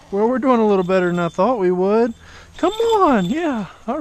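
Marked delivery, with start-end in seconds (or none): 2.6-4.0 sudden falsetto come-on; high, loud coaxing to the hawk; high coaxing call. Real, not acted. Coaxing the bird to the glove.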